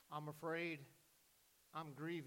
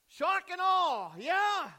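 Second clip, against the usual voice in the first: much higher and louder, slow amazed cry; awed exclamation; 12 semitones higher, 13 dB louder, much slower than usual. Acted. Pastor acting out the homeowners' reveal, loud and awestruck. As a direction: burst out in wonder at the reveal. Families see their restored house.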